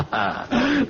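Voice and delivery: mocking laugh. Villain laughs after "Why Toddy?.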